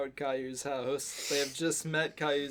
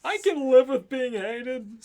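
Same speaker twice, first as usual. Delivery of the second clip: put-on higher, louder teen voice; Sam voice; 7 semitones higher, 8 dB louder than usual. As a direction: toss it off as Sam. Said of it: "Sam says".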